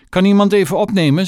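high, loud and hurried, mock solemn; mother solemnly asking someone to answer. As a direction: play the grand lady calling for the phone. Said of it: "zei moeder plechtig".

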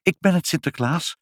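high, timid little answer; a touch of flustered stammer. A narrator's reading. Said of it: "stamelde moeder".

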